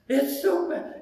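high, whiny mock sobbing; imitated crying. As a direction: wail it as the weeping woman would. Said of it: "she's just crying".

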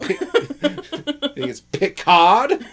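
Picard drawn out slowly, laughing; mock British Picard. Defending his Brit Picard, slow and laughing. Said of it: "that's how the Brits do it".

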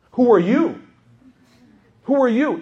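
high, loud indignant challenge; mock outrage at being advised. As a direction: throw it out sharply, affronted by the advice. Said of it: "just feels irritated".

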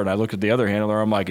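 normal talking voice

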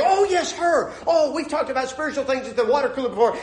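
high, eager acted recognition; light up with high-pitched enthusiasm; excited. Churchgoer spotting a coworker in the office, acted excitement. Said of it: "and you get all excited".

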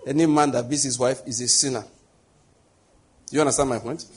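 usual speaking voice

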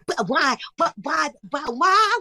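high, climbing mock cries; mock exclaiming. Acting out the madness on stage, high exclaiming.

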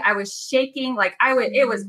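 ordinary talk

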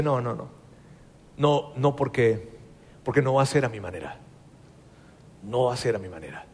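más suave y baja, firme sin alzar la voz; niégate con calma y convicción; negativa serena. Acted. David rechaza matar a Saúl. (David rechaza la tentación en voz baja y firme)

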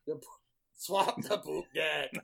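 gruff quiet pirate growl. Pretend. Pirate joke banter.

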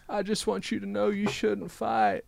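a mock Clinton as mediator on the phone; Clinton impression. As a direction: play Clinton calming a fight. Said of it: "It's me, former President Bill Clinton".

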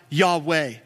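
ordinary talk

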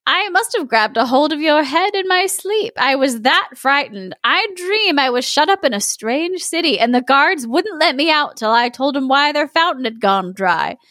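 put-on high, innocent old woman; sassy grandmother. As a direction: play the frightened granny, lightly. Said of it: "said she".

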